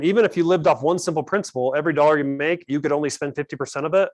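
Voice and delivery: usual speaking voice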